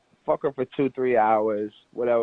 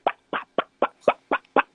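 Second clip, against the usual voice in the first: popped-out imitation of rifle shots; mock AK-47 gunfire. Man illustrating his point to the group, mock gunfire. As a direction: mouth the gunshot pops, voiceless and percussive. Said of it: "busting like a motherfucking A K".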